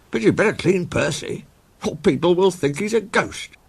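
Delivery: very high, surprised railwayman; a startled signalman squeaks the question. Acted. Signalman to Percy's driver, high surprised voice.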